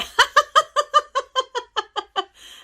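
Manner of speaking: high loud burst of laughter. Real, not acted. Laughs at comment.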